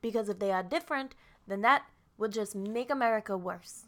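lightly voiced mock politician; botched Trump impression. Continuing the Trump speech about supers, mock politician voice. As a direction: deliver it as a mock stump speech. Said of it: "That sounds like Bernie Sanders".

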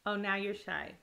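ordinary talk

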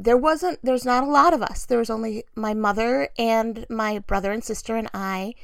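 ordinary talk

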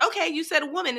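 high, bright mock-eager claim; eager hopeful voice. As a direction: play her trying again. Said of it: "And it's like".

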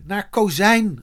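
a narrator's contained shout; shouted instruction. A narrator's reading. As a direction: call the play out, voice lifted. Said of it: "Eddie kon het niet langer aanzien en schreeuwde".

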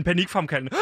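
normal talking voice

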